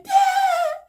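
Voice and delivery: high, loud frightened cries for dad; scared child calling. Retold or imitated. Stuck on a car by a snake, calling dad in panic. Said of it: "like, calling my dad".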